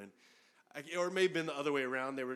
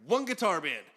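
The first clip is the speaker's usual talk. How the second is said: louder, pointed retold retort; comeback; 5 semitones higher, 7 dB louder than usual. Retelling his answer to John, smug comeback. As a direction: deliver the zinger smugly.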